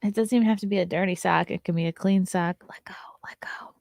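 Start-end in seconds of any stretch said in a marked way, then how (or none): 2.7-3.8 whispered, very soft; soft whispered let go to the dog. Real, not acted. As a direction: hush it out while tugging. Tugging sock from dog.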